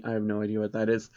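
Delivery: the reader's quick, soft aside to the listener; quiet aside. Real, not acted. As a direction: drop out of the story to mutter a fast aside. Reader aside about creature.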